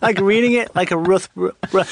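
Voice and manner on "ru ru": usual speaking voice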